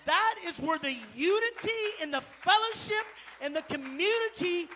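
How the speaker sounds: loud, slow, high preaching. Acted. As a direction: drive the point home to the congregation. Preaching climax.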